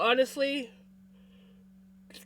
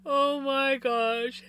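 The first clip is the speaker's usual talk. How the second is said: slow, stretched and louder oh; drawn out oh my gosh; 5 semitones higher, 6 dB louder, much slower than usual. Real, not acted. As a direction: pull the oh out in delight. Greeting chat, drawn-out exclamation.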